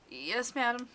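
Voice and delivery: high, squeaky meek reply; mewling squeak. Acted. Scolded visitor obeying the woman, mewling squeak. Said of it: "my mewling squeak".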